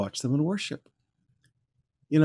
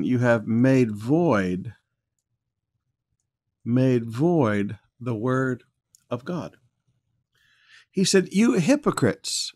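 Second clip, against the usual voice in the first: preaching scripture; 5 dB louder than usual. Acted. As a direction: proclaim the verse from the pulpit.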